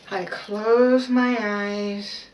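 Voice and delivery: slow, drawn-out calm voice; slow meditation demo. Shown as a demonstration. Showing her followers her meditation, slow and calm. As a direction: ease into it slowly, eyes closed. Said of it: "Let me give you guys a sample".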